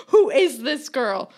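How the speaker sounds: loud, slow, laughing outcry; hammed-up shout with a laugh; mock yell. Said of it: "everyone is screaming at me".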